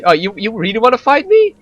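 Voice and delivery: rising, cocky questions daring someone to fight; mock challenge. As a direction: toy with a would-be challenger. Acts out taunting a challenger.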